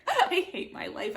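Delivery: mock-despairing through a laugh. Real, not acted. Joking despair about reading list.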